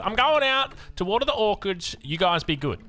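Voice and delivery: mock yell from a mother on her way out; imitated mum yelling. Mum calls to the kids from outside, mock yell. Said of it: "she yells out".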